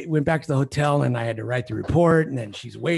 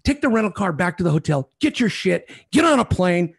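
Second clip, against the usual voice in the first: loud, higher furious tirade; livid rant; 6 semitones higher, 6 dB louder than usual. Retold or imitated. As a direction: yell it all at her. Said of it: "I was livid".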